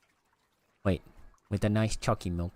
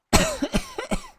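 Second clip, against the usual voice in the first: high squeaky cough; 5 dB louder than usual. Real, not acted. Loud high cough alone.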